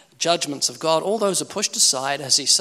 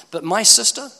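ordinary talk

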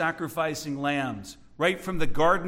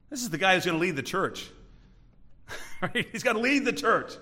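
ordinary delivery

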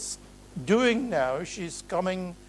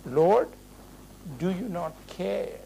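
normal talking voice

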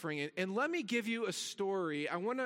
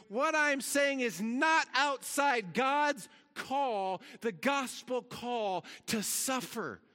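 higher, louder pulpit push; rising preacher's emphasis, building intensity; preaching at full force; 6 semitones higher, 4 dB louder than usual. Acted. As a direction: drive the point home to the congregation.

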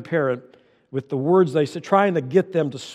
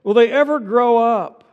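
higher, louder mock-frustrated parent; exasperated parent; 8 semitones higher, 5 dB louder than usual. Preacher voicing a fed-up parent, higher and louder. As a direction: vent like a parent at wit's end. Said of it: "You say".

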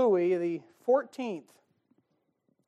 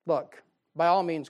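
normal talking voice

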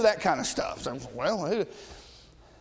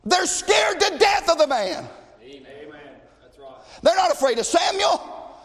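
preaching at full force; 5 semitones higher than usual. Acted. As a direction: thunder it from the pulpit.

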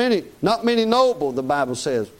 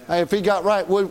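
normal talking voice

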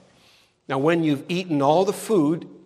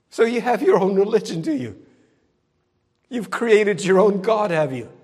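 louder, higher jabs with tag questions dropping away; scornful challenge; 5 semitones higher, 6 dB louder than usual. Acted. Preacher confronting someone with their own religion, scornful challenge. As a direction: throw it back at them, loud and incredulous.

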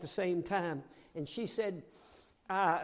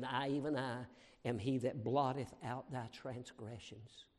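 low, soft, reverent quoting; hushed scripture; 6 semitones lower, 7 dB quieter than usual. Retold or imitated. Preacher quietly quoting God's words, low and soft. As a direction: say it low like a secret. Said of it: "God said".

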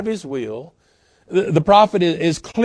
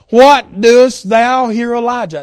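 slow, loud, higher-pitched challenge; emphatic key verse; 6 semitones higher, 8 dB louder, slower than usual. Acted. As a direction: ring the question out word by word.

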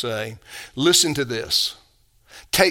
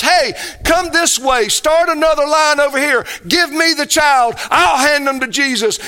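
imitating helpful disciples; 10 semitones higher, 5 dB louder than usual. Mock disciples helping parents.

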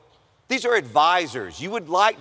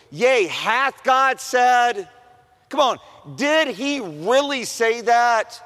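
slow, sly, higher voice of the tempting snake; serpent's voice; 6 semitones higher, slower than usual. Acted. The serpent coaxing Eve to doubt, slow and sly. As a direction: draw the words out like the snake in Eden.

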